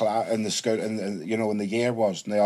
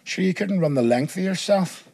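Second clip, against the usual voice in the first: higher, scornful retold put-down; mock disbelief, pitched up; mocking teacher; 7 semitones higher than usual. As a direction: imitate a teacher ridiculing a pupil. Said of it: "he goes like that".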